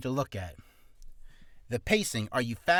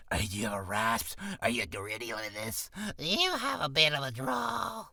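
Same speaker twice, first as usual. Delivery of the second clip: texture demo; 2 semitones lower than usual. Shown as a demonstration. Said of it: "Texture is".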